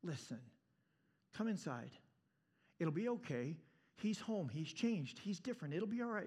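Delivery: quietly coaxing an upset son; gentle pleading. Acted. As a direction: plead gently in short phrases with pauses between. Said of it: "he pleads with him".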